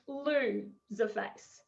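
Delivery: normal talking voice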